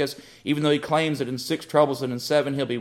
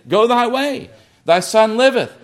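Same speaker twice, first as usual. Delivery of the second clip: higher and louder, ringing out "Go thy way"; proclaimed command; 7 semitones higher, 4 dB louder than usual. Retold or imitated. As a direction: announce it with authority. Jesus's words repeated, proclaimed.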